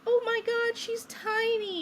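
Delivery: high, drawn-out imitation of cooing wonder; retold amazement. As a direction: marvel over a newborn, stretching the last word. Everybody marvels at the newborn.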